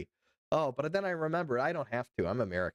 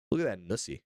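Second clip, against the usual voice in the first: normal talking voice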